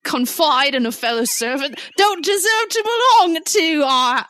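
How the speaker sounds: hammy, badly faked British accent; horrible British accent. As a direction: play the indignant servant in a terrible British accent. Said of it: "forgive me for this horrible accent".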